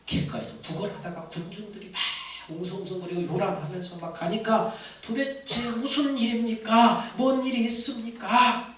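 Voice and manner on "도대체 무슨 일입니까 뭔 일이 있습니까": raised, slow questioning call; blind beggar asking. Retold or imitated. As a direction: ask loudly what is going on, drawn out. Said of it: "물어보니까".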